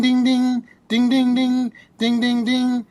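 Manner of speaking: high, loud ringing dings; imitated bicycle bell. Imitating the bell of the man on the bicycle, high and ringing. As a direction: chime it out like a bike bell. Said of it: "I start hearing this ding".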